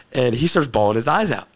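ordinary delivery

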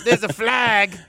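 put-on slow, sloppy drunk fan yelling about the field. Host imitating his drunk cohost looking for the players, slow and sloppy. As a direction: slur it like a fan drunk at ten AM. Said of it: "and you're probably like".

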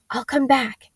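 usual speaking voice

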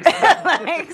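high, loud laugh-through. Real, not acted. Laughing about knowing every line. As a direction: laugh out the word.

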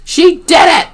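high, loud cheer; triumphant cry. A narrator's reading. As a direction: shout it in triumph. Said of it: "I cried".

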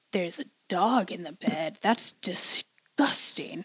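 soft, appalled near-whisper, slowing right down; hushed disgust. Retold or imitated. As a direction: imitate someone quietly horrified, dragging out the last words. Imitates disgusted man seeing dog.

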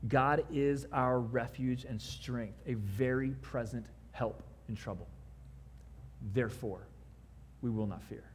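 measured scripture reading from the pulpit. Acted.

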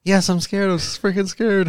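imitating scared woman. Woman answering Mister Big, imitated in a high panicky voice. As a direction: mimic a terrified victim squeaking out her answer. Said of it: "she's, like, screaming and everything".

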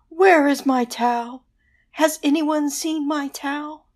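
high, floaty ghost calling; searching ghost, high and eerie. Acted. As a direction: call out like a lost ghost.

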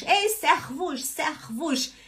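servus said sɛxvuʃ with a throaty R; teacher showing the wrong way; wrong pronunciation. Shown as a demonstration. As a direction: exaggerate the harsh R in servus. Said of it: "Isso é errado".

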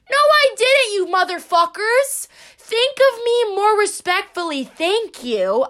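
high-pitched, playful outrage; mock indignation. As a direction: scold the listeners in mock offence.

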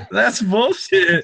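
high, slow laughing disbelief. Real, not acted. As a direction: laugh through the words. Laughing reaction to joke.

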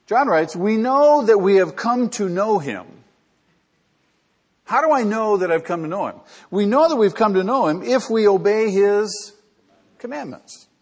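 full-force sermon delivery; fervent preaching. Acted. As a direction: preach it out to the whole room.

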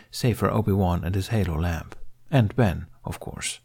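plain reading voice

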